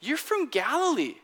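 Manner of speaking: high-pitched mock accuser, settling at the end; accusing servant girl. Servant girl recognizes Peter's accent, high-pitched accusation. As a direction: call him out as the suspicious girl. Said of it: "she recognizes his accent and says".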